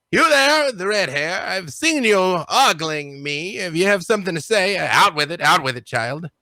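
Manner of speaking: haughty captain calling someone out; imperious captain. Acted. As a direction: challenge a staring youngster in a lofty captain's voice.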